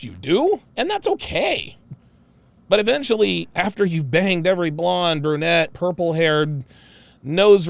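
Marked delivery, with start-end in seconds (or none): none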